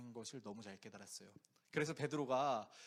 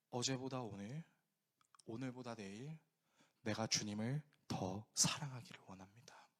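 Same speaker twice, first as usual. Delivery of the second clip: soft unvoiced whisper; whispered prayer; 6 semitones lower, 4 dB quieter than usual. Preacher voicing a believer's quiet prayer, whispered. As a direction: breathe the prayer tenderly.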